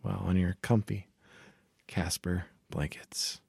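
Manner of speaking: soft, slow sponsor read; mock ad read.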